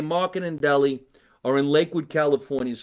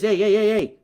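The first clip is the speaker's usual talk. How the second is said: higher, louder hey hey hey to break up the crowd; calling out over a crowd; 6 semitones higher, 5 dB louder than usual. Pretend. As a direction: call a noisy crowd to order. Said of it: "I'd say like this".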